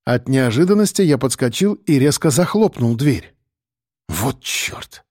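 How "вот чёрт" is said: low, soft exhaled oath; exhaled curse. A narrator's reading. Narrator exhales after slamming the door on a dog, soft low curse. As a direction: breathe the curse out under your breath. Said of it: "выдохнул я".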